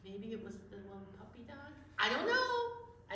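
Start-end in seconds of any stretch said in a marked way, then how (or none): 2.0-3.1 puppet voice. Pretend. Puppet shrug.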